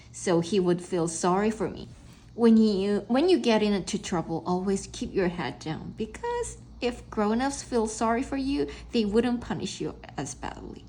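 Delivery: usual speaking voice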